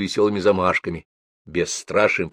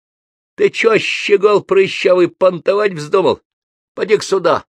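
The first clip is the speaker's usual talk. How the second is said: put-on much higher, louder threatening old man; bully the cocky kid like a crook; menacing old thug; 11 semitones higher, 6 dB louder than usual. A narrator's reading. Criminal old man threatens boys. (угрожающий блатной старик, высоко и громко)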